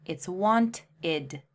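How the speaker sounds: want-id split slowly into two syllables; want, then id, slow. Shown as a demonstration. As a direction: break wanted apart slowly. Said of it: "wanted has an extra syllable".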